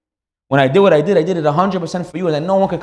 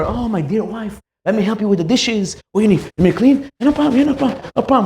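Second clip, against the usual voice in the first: high, syrupy eager-to-please voice; fake sweet husband; 6 semitones higher than usual. Husband pretending to help wife while guests watch, syrupy. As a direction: overdo the helpful husband for show.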